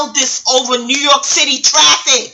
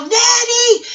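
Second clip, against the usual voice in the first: Color Purple yell; 11 semitones higher than usual. Retold or imitated. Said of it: "You saw she yelled".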